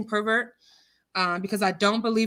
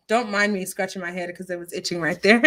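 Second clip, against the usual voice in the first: ordinary talk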